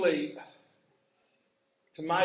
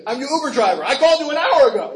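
comic impatience; 6 semitones higher, 7 dB louder, faster than usual. Retold or imitated. Comic Uber driver analogy voiced.

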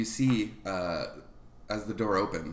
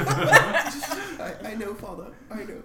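put-on high, hesitant boyish voice; shy boy Willis; 5 dB louder than usual. Boy greeting the scoutmaster at his door, shy and high. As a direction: stammer politely to a guest.

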